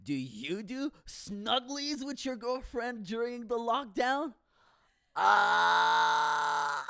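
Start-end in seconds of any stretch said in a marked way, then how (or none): 5.1-6.9 long loud scream; exasperated scream. Acted. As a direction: scream at your own embarrassment. Scream after snugglies confession.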